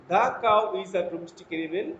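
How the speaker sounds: "The cow" with 'The' as a short thuh, slow. Shown as a demonstration. Teacher reads an example to students, The as thuh. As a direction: read slowly, The as thuh. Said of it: "আমরা দি না বলে দা উচ্চারণ করব".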